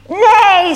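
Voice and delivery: high, loud imitated neigh; whinny the word out loudly; horse's neigh. A narrator's reading. Horse in a children's story, loud high neigh. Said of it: "said the horse".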